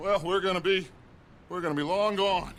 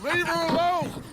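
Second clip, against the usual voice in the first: sharp, loud and high: leave her alone; angry shout; 9 semitones higher, 3 dB louder than usual. Acted. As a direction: bark it to stop him cold. Stopping Micah harassing woman.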